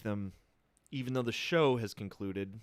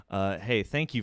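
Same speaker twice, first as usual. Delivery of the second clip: ordinary talk, nothing marked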